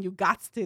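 "you gots to" said casually, gots with an s; slangy "you gots to". Retold or imitated. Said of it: "why I'm saying".

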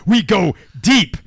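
podcast signoff delivered with weight; slogan tag. Shown as a demonstration. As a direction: land the tagline slowly. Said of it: "show slogan".

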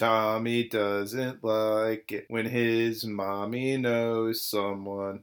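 low, slow and sneering; slow mocking drawl. Real, not acted. Host mocks Tommy's mommy complex, low and slow. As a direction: drag it out like a taunt.

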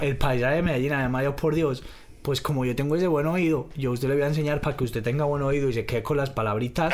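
chatty Medellín paisa accent; sing-song Colombian paisa delivery. Shown as a demonstration. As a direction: play a paisa teaching by ear. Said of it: "te puedo hacer el acento parse".

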